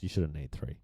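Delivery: ordinary delivery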